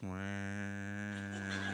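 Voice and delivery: long, low, soft "wah"; imitated oblivious drone. Mimicking the unheeding boat crew, a low soft drone.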